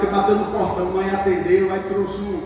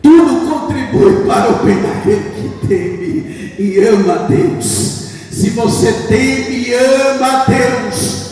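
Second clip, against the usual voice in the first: loud fervent preaching; 4 semitones higher, 6 dB louder, slower than usual. Acted. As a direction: proclaim it to the church. Preaching to congregation. (pregação fervorosa em voz alta)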